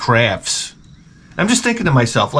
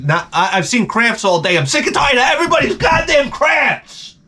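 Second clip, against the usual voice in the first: building to a high-pitched shout; exasperated rant exploding into a yell; pissed-off rant; 9 semitones higher than usual. Retold or imitated. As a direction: act out losing it completely. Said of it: "now I'm getting pissed".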